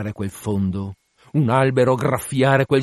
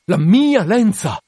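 loud, high, drawn-out cry; horrified outcry; 8 semitones higher, 6 dB louder, slower than usual. Acted. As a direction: cry out slowly, stretching MIA. Captain repeats it, now crying out.

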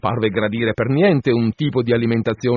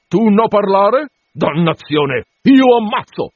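loud, raised cannibal threat; menacing guttural cannibal; 8 semitones higher, 5 dB louder, slower than usual. A narrator's reading. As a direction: threaten loudly in broken speech, pitch jumping up. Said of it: "le sue risposte gutturali".

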